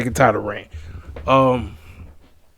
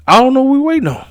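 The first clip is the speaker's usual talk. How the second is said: exasperated; 12 semitones higher, 4 dB louder, faster than usual. Real, not acted. Exasperated about long wait.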